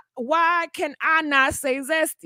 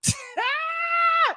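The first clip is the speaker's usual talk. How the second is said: very high shrieking cackle; shrieking laugh; 13 semitones higher than usual. Real, not acted. Laughing at own joke.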